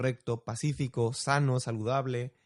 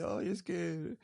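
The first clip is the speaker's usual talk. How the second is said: slow, mildly tearful imitation of a sobbing teen; a touch of teary whimper, drawn out; sobbing girl imitated; 4 semitones higher, 3 dB quieter, much slower than usual. Retelling a student crying over exams, mild mock sob. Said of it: "entre sollozos me dice".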